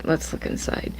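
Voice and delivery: breathy whispered repeat; whispering. Real, not acted. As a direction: whisper it to yourself.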